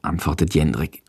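ordinary delivery, nothing marked

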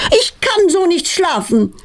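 high-pitched outraged mother, narration volume; mock yell. A narrator's reading. Mother complaining through the whole flat at night, high mock yell. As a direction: cry out high without real shouting. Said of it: "brüllt Petronellas Mutter laut".

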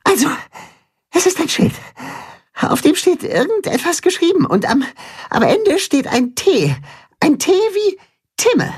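hohe Maulwurfstimme, leicht gehetzt; außer Atem berichtend. A narrator's reading. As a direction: berichte hastig wie nach dem Tunnellauf. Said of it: "berichtet außer Atem". (Maulwurf kommt aus dem Tunnel, hoch und gehetzt)

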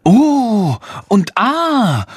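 slow, high drawn-out oh and ah; amazed passers-by. A narrator's reading. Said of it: "rufen Passanten". (staunend, hoch und gedehnt)

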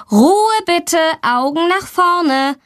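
a stern teacher hushing the class; teacher scolding. A narrator's reading. Teacher hushing chatting girls, firm. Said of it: "ermahnt sie die Lehrerin".

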